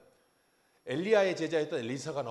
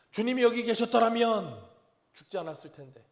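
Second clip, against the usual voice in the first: resentful lament; 5 semitones higher, 5 dB louder than usual. Retold or imitated. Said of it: "얼마나 화도 나고 원망도 하고".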